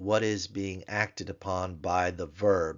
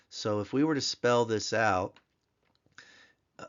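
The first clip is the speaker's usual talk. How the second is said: ordinary talk, nothing marked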